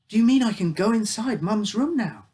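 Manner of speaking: put-on a boy who can't believe his luck; bursting with hopeful excitement; excited boy. Boy eager to see mum.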